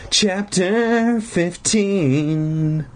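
high, drawn-out chapter title; hammed-up chapter announcement. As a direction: stretch the title out high and theatrically.

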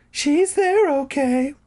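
very high put-on woman voice; squeaky high character voice; Carolyn voice. A narrator's reading. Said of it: "Carolyn smiled".

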